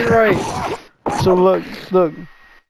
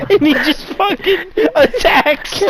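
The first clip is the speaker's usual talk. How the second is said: high wheezing laughter; laughing; 12 semitones higher than usual. Real, not acted. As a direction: crack up mid line. Laughing at own joke.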